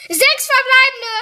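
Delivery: sudden loud jump in pitch; surprised. Real, not acted. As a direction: blurt it out in surprise. Unerwartet sechste Megabox. (überrascht laut aufgerufen)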